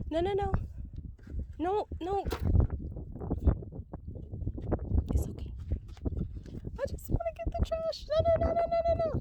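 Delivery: begging the horse to let her through; desperate high pleading. Real, not acted.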